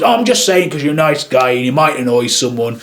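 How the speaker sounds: ordinary talk, nothing marked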